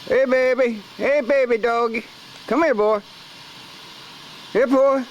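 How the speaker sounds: high coaxing call to a dog; calling a lost pet. Real, not acted. Calling a stray dog over.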